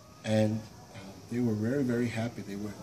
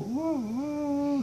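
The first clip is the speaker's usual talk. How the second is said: high whimpering wail; whimpering people; 4 dB louder than usual. Acted. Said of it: "they were all whimpering".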